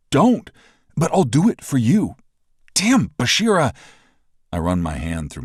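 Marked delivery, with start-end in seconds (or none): 0.1-3.8 exasperated. Acted. Exasperated reply in argument.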